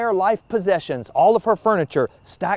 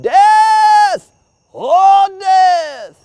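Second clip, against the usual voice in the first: full-throated yell, much higher and louder; 11 dB louder, much slower than usual. Acted. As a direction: bellow it down the empty street. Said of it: "he yelled out".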